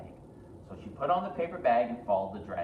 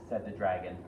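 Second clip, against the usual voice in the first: usual speaking voice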